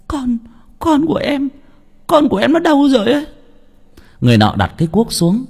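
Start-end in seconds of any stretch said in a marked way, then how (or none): 0.1-3.2 very high, breaking into a mother's wail; tearful whimpering. A narrator's reading. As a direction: start small and grow into a tearful plea. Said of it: "Chị Bảy mếu máo". (mếu máo, giọng cao nghẹn ngào)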